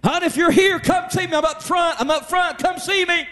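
high, mother calling over the store intercom. Retold or imitated. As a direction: call the daughter over the PA. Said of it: "on the intercom".

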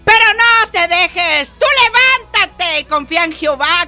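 fervent preaching. Acted. Pastor urging the congregation not to fear, fervent. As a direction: lift them up with conviction.

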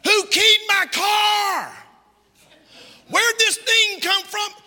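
high, loud, drawn-out mock yell; outraged shout. Preacher imitating someone finding their car damaged, shouting. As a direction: bellow it slowly in acted outrage. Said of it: "Thought you said you didn't shout".

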